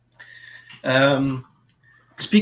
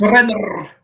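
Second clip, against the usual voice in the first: louder, rolled-R snarl on Surrender; Seventh Doctor snarl; 3 semitones higher, 7 dB louder than usual. Retold or imitated. Imitating the Seventh Doctor for cohosts, louder rolled-R snarl. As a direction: roll the R of Surrender like a grand threat. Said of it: "he would snarl".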